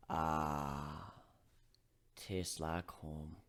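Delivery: low, slow, wistful murmur; wistful sigh. Acted. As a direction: savour it like a memory. Wistful sip of mead.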